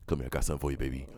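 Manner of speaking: ordinary talk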